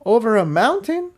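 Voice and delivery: a pony stunned by the idea; incredulous. A narrator's reading. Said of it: "Tiptoe said incredulously".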